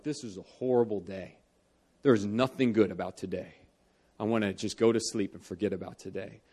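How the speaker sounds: gloomy self-talk. Retold or imitated. Retold gloomy self-talk on bad days.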